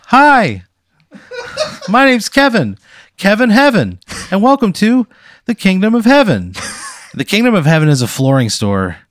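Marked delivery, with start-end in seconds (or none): 0.1-6.5 high, loud, nervous local ad voice; nervous TV pitchman. Acted. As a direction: play an awkward local TV salesman. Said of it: "He goes".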